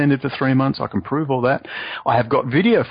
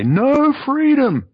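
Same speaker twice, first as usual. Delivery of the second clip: very high, drawn-out, louder mock quote; quoting the minders; 5 dB louder, much slower than usual. Minders in the car handing him vodka, mock quote. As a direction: stretch it out high like a mocking chant. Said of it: "That's a quote".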